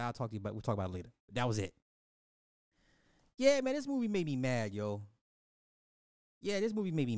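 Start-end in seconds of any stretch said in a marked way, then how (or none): 0.0-1.1 softer, lower, putting him off; brushing it off quietly. Retold or imitated. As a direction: mumble it aside for later. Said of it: "He said".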